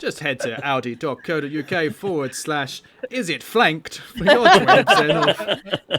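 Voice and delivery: mock ad read.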